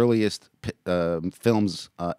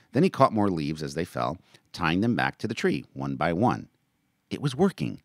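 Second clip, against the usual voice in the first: lively read-aloud of a novel; story narration; 2 semitones higher, 4 dB louder than usual. A narrator's reading. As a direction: narrate the chapter with voiced characters. Reads his novel aloud.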